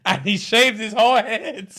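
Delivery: high, breaking into laughter mid-sentence; can barely get the words out for laughing; real laughter while telling the punchline. Real, not acted. Teller cracking up at punchline.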